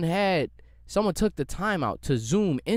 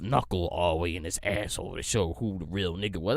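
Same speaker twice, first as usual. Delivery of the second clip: mock old head; 8 semitones lower, 5 dB quieter than usual. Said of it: "y'all saying".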